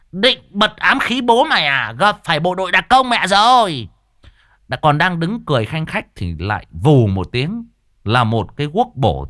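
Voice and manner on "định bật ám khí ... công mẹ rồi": high, loud gloating; cackling boast. Acted. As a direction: crow triumphantly over a failed attack.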